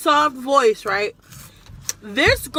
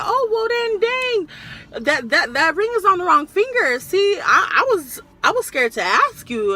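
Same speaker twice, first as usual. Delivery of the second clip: high, hammed-up flirty customer voice; mock nervous admirer, pitched up; imitating the guy; 7 semitones higher, 3 dB louder than usual. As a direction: act out the nervous guy asking her out. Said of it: "And he was like".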